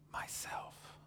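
barely audible whisper; soft whisper. A narrator's reading. Unseen lion answers a third time, whispering softly. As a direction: breathe it so softly it can hardly be heard. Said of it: "whispered so softly you could hardly hear it".

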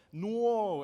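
put-on a light New York "No" from the toddler; New York accent. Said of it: "kind of a New York accent".

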